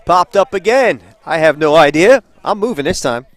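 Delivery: loud, urgent live call; announcer ducking an incoming foul ball; real alarm. Real, not acted. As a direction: raise your voice, ready to bolt. Second foul ball coming at booth.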